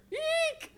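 very high squeal. Acted. Character squealing as the van lurches, high eek. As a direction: squeak in fright.

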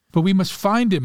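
urgent, high-pitched plea; restrained outcry, voice lifted high; crying out. A narrator's reading. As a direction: cry it out without shouting. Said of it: "Marcia cried".

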